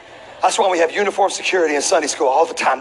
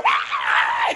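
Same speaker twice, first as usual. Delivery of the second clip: breathless laugh. Retold or imitated. Reenacting crying and flailing.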